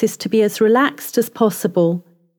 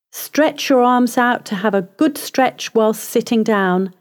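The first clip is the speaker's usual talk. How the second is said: ordinary delivery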